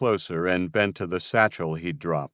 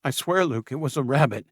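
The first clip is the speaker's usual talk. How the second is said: high, pleading, a touch scared; frightened; 7 semitones higher, faster than usual. Acted. Julian frightened by Luke's gaze, pleading high. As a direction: swear it anxiously. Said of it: "apparently frightened".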